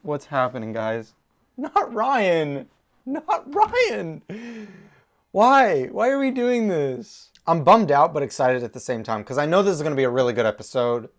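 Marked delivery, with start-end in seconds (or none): 1.6-4.2 high, pleading whine; plaintive high lament; distressed whine. Real, not acted. Dismayed about favourite character. 5.3-7.3 higher, drawn-out lament; plaintive, upset questioning; dismayed whine. Real, not acted. Lamenting what happens to Ryan.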